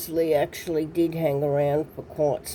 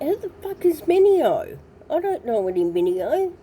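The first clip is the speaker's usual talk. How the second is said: baffled outburst; 10 semitones higher than usual. Retold or imitated. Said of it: "I'm like".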